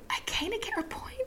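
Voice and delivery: whispered like a guilty secret. Real, not acted. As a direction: drop to a whisper. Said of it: "I feel like I shouldn't".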